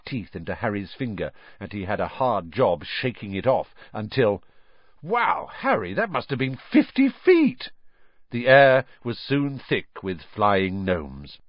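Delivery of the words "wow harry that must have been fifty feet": impressed. Acted. Twin impressed by throw.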